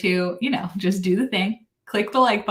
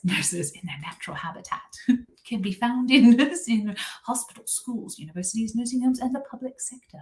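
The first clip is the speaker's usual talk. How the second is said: mock wildlife narrator, breaking into giggles; David Attenborough; 4 semitones higher than usual. Streamer narrates nurses' natural habitat, laughing documentary impression. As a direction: play a British nature presenter while trying not to laugh. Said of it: "And I say, like".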